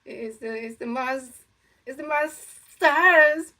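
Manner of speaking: high, slow, loud stretched cartoon cat wail; Sylvester the Cat scared. Kid doing Sylvester afraid, stretched high wail. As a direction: drag out my stars like a frightened cartoon cat. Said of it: "when Sylvester the Cat be saying".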